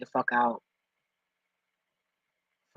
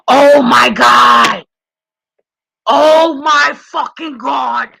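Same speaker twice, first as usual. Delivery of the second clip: gasp, then a much higher, louder, slow shriek of shock; screaming in genuine alarm; real freaked-out shout; 11 semitones higher, 16 dB louder, much slower than usual. Real, not acted. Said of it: "Yo, I'm freaking out".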